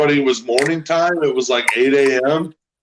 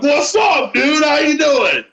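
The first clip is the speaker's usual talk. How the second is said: much higher, shouted cheery hello; overly cheery greeting; 11 semitones higher, slower than usual. Retold or imitated. To the grumpy semi driver, an over-cheery high greeting. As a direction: holler a hello way too happily. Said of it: "I'm like".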